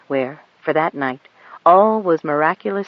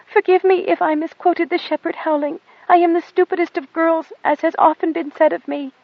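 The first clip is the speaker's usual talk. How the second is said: put-on high, humble little girl begging forgiveness; meek pleading girl; 12 semitones higher than usual. A narrator's reading. Goat Dung begs the pilot's pardon in a high meek voice. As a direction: grovel before the captain of angels. Girl's self-abasing plea.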